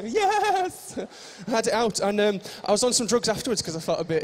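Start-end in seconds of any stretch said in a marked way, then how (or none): 0.0-1.0 high, drawn-out gleeful cheer; mock enthusiasm. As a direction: squeal a long yes in pretend delight. Mock enthusiasm about loving small organs.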